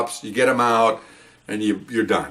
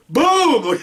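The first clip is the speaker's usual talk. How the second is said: high, laughing boom; startled boom; 4 dB louder than usual. Retold or imitated. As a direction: burst out with the shot, laughing. Retelling sudden gunshot.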